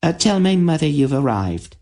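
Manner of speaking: put-on light, high girlish voice; a young dryad passing on a message; dryad Zera. A narrator's reading. To the visitors, a high girlish dryad voice.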